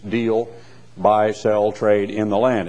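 normal talking voice

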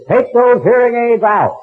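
high, slow, loud order like a showman preacher; mock faith healer command. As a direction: boss him like a tent healer. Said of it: "he says".

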